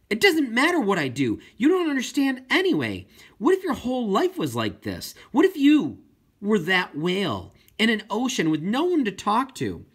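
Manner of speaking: high, loud and rising in anger; frustrated outburst. Acted. Girl to her dad who won't listen, furious. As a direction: let it spill over, pressing him harder.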